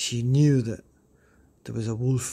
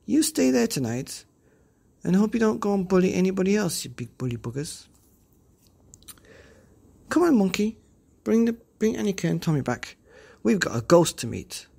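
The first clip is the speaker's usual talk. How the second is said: put-on lightly raised bold girl voice; Pippi scolding; 6 semitones higher, 3 dB louder than usual. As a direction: tell the bullies off, cheeky.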